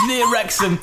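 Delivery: ordinary talk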